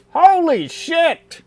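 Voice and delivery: high, slow, startled outburst; a guard blurts out in shock; shocked cry. Acted. Guard cries out in surprise.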